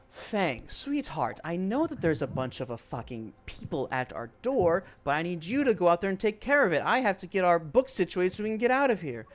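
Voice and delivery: hurried orders to her henchman; Inga's voice. Retold or imitated. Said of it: "She goes".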